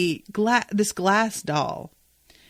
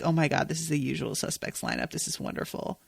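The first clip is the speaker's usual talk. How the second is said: much softer, fading to a whisper of wonder; awed hush; 5 semitones lower, 8 dB quieter than usual. Retold or imitated. Host recalling spotting the lineup, hushed awe. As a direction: breathe it out in quiet amazement. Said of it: "I'm just like".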